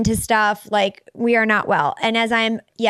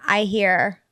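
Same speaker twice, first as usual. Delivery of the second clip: ordinary talk, nothing marked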